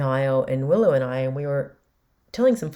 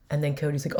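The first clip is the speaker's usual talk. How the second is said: ordinary talk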